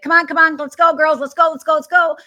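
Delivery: loud, high, urgent hurry-up call; retold yell. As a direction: yell to rush the girls out the door. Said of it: "my dad yells again".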